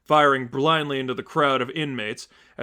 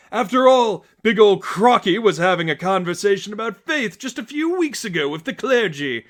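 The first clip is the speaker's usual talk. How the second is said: much higher, loud manic cheer; Joker voice; 8 semitones higher, 6 dB louder than usual. Acted. The Joker cheers Harley's arrival, manic and loud. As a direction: greet her like a gleeful madman.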